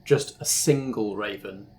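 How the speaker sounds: ordinary delivery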